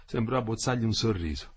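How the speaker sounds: ordinary delivery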